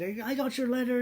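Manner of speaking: put-on high, loud Australian accent; boisterous mock-Australian voice; Australian businessman. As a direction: imitate an outgoing Aussie caller. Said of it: "Australian businessman".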